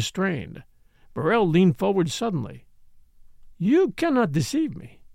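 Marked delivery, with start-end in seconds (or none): 3.6-5.0 murmuring. A narrator's reading. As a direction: murmur knowingly. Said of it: "she murmured".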